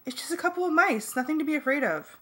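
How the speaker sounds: high-pitched projected call; mild yell in a high voice. A narrator's reading. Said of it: "Cassie yelled as loudly as she could".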